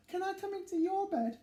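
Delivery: high small begging voice; pleading little mouse. Acted. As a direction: ask sweetly to be let in.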